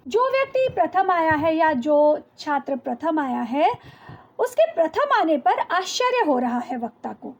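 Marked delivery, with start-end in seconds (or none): none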